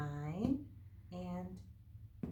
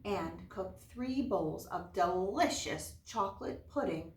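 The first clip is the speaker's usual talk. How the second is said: bright, louder read-aloud storyteller voice; story narration; 3 semitones higher, 6 dB louder than usual. Acted. Teacher reading a fairy tale to her class, lively storyteller voice.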